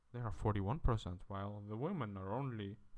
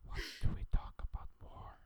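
unvoiced whisper. Real, not acted. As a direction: whisper into the mic. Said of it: "I have to whisper".

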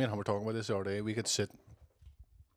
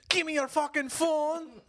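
high, slow, louder mock yell; mock demand; 5 dB louder, slower than usual. Quoting Murphy to friends, hammed-up high slow yell. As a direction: bark it out like a hammed-up thug. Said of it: "he's all".

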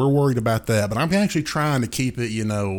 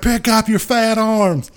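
high, slow, louder mock yell; angry friend; 11 semitones higher, 5 dB louder, slower than usual. Friend ordering him off the button, mock yell. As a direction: bark it out in exaggerated fury. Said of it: "He's like".

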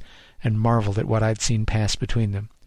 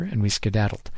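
ordinary delivery, nothing marked

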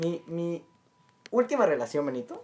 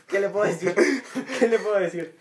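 higher, louder, laughing through the words; laughing shrug; 5 semitones higher, 4 dB louder than usual. Real, not acted. Host jokes about the ex's missed chance, laughing helplessly. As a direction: toss it off while cracking up.

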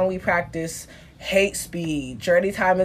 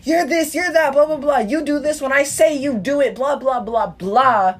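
higher-pitched imitation of someone ordering people around; 5 semitones higher than usual. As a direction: rattle off demands in a raised, mocking pitch.

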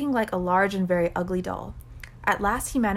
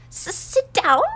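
high, loud, stammered wail; overwhelmed elf wail; 11 semitones higher, 6 dB louder, slower than usual. A narrator's reading. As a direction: burst out tearfully in an elf voice. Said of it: "he wailed".

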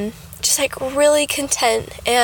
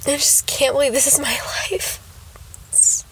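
whispering; 4 dB quieter than usual. Real, not acted. Awed whisper of joy.

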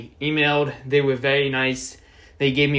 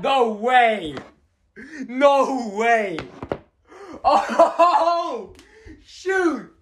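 high, laughing shrieks of disbelief; shrieking jackpot winner; 10 semitones higher, slower than usual. Real, not acted. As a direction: scream with joy at a huge hit. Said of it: "started going crazy".

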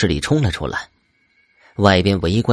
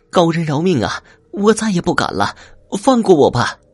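high-pitched desperate pleading; begging for mercy; 8 semitones higher than usual. A narrator's reading. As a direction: beg to be spared in a high voice. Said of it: "一边不停的求饶".

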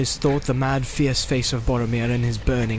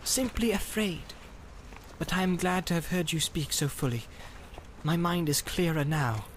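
softer, higher hobbit voice; gentle, raised-pitch Frodo; Frodo, honest and afraid; 4 semitones higher, 6 dB quieter than usual. Acted. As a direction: confess it simply and softly.

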